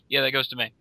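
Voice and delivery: ordinary talk, nothing marked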